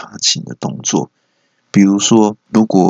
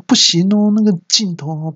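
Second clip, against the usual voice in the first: excuse-making; 10 semitones higher than usual. Acted. Employee making excuse to boss.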